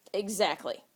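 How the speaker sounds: usual speaking voice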